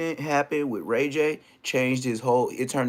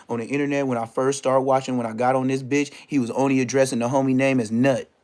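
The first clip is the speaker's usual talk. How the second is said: ordinary talk, nothing marked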